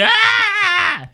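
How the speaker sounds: loud high stabbed scream; death cry. Pretend. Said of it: "you need to scream this one".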